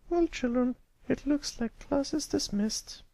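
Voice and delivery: high patient teacher voice. A narrator's reading. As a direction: speak as a kindly schoolteacher to a pupil. Miss Shirley voiced.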